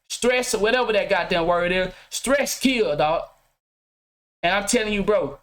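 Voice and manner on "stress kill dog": ordinary talk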